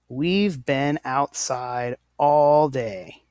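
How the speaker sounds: We've been outside all day read very slowly; slow example sentence. Shown as a demonstration. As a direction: model the sentence slowly for the class. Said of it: "It's faster to say".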